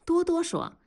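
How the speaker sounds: ordinary delivery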